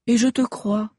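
high, loud sneer; mocking witch assurance. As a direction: sure of herself, taunting. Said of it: "assura la sorcière". (sorcière moqueuse et sûre d'elle)

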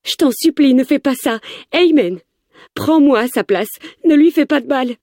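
high-pitched desperate begging; imploring. A narrator's reading. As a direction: plead for his life, voice pitched right up. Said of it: "je l'implore". (supplication aiguë et désespérée)

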